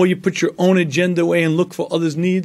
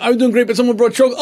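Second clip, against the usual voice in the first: rushed confession; 7 semitones higher, much faster than usual. Retold or imitated. Dieter at Weight Watchers blurting out her chocolate slip, rushed and higher. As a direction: blurt it out in a hurry, half-joking. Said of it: "Another one".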